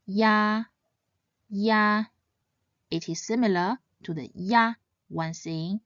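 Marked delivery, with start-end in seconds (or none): 0.1-2.0 "ya" run together as one seamless syllable; one-syllable glide. Shown as a demonstration. Teacher models "ya" for learners, one seamless syllable. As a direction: say "ya" as a single glided syllable, held level. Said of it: "when said together quickly, the compound final should be pronounced as".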